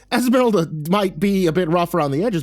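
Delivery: high, hurried reassurance; gasped. A narrator's reading. Narrator covering for Esmeralda to Rachel, gasped and high. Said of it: "I held up my hands and gasped".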